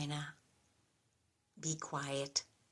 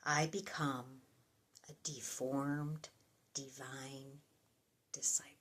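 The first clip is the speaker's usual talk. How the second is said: softer, reflective reading with long pauses; prayerful psalm reading; 5 dB quieter than usual. Acted. Addressing God at the close of a psalm, soft and prayerful. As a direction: let each phrase fall away softly, like a prayer.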